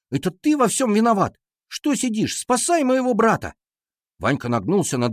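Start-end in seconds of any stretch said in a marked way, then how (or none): 0.1-3.5 high-pitched, frantic accusation; lashing out. A narrator's reading. Brother blames Ванька and demands rescue, frantic attack. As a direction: go at him in alarm. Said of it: "Я набросился на Ваньку".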